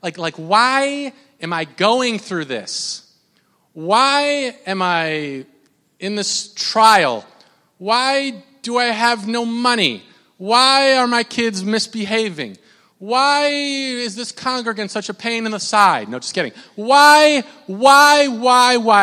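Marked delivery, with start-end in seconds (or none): 0.3-16.1 long drawn-out, pleading "why"; anguished questioning. Acted. Preacher voicing his doubts in a trial, anguished questioning. 16.8-19.0 loud, high, drawn-out wails of "why"; anguished cry. Acted. Preacher acting out asking God why, anguished cry.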